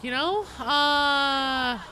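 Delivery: drawn-out mock yell. Real, not acted. Exaggerated drawn-out outburst.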